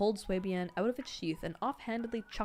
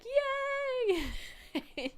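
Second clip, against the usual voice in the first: very high, squealed "Yay!"; mock cheer. Reader imitating the Lan juniors cheering, squeaky high. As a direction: cheer like giddy kids. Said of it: "the Lan Juniors being like".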